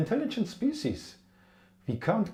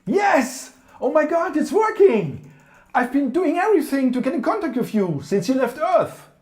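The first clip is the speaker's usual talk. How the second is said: high, loud and overjoyed; excited shout; 10 semitones higher, 8 dB louder than usual. Acted. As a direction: shout it with sudden relief. Finally makes contact, overjoyed.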